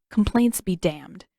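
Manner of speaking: usual speaking voice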